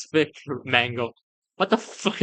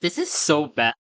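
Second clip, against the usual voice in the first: ordinary delivery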